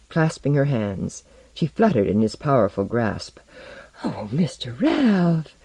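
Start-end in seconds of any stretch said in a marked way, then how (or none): 4.0-5.5 high, slow swooning Southern belle; faint cry. A narrator's reading. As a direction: swoon it out slowly. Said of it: "she cried faintly".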